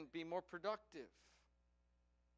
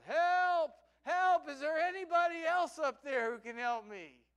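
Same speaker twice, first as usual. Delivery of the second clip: high, very loud yell; yelling for help; 9 semitones higher, 11 dB louder than usual. Acted. Jack on the branch yells up for someone else. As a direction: yell past God for any other rescuer. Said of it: "Jack yelled".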